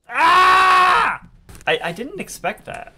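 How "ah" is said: loud, high-pitched yell; startled scream. Real, not acted. Player caught by surprise movement in the game, startled scream. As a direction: scream out at a sudden shock.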